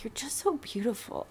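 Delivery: whispered, imitating an adoring fiancé; tender whisper. Fiancé gazing at her before proposing, tender whisper. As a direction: hush it right down, tenderly quoting him.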